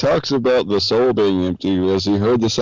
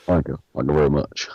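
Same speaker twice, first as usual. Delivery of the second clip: low, jowly Nixon impression of Dracula; Richard Nixon voice; 5 semitones lower, 4 dB quieter, faster than usual. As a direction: lower Nixon-style Dracula. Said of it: "turned into a Dracula Richard Nixon".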